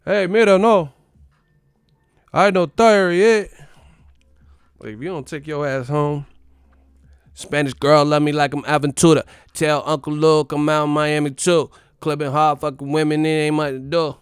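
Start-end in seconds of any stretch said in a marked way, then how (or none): 0.0-3.5 put-on loud, high partying Cuban accent. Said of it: "You at the, at the bachata". 7.3-14.2 put-on Cuban accent. Fighter partying in Miami quoted.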